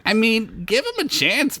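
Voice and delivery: high voice talking through food; mouth full. Acted. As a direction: speak around a mouthful of fries. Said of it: "said with a mouthful".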